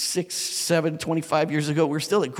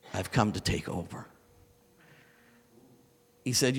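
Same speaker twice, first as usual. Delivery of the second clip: the Lord speaking; 4 semitones lower, 5 dB quieter than usual. Retold or imitated.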